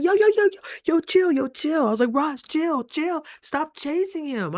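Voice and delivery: frantic, high-pitched pleading to chill; panicked yelling. Retold or imitated. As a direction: yell it, scared of the bird. Panicked at goose charging her.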